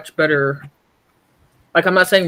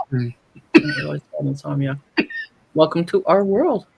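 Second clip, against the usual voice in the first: ordinary talk, nothing marked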